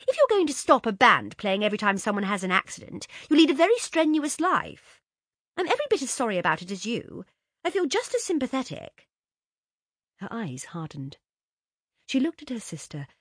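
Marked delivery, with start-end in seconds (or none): 0.1-9.1 louder and higher, rising irritation; annoyed. Acted. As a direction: scold the little sister, exasperated. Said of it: "Josie began to be seriously annoyed".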